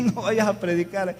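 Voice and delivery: loud, high-pitched refusal; acted-out exclamation of protest; alarmed protest. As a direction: imitate a cousin objecting loudly.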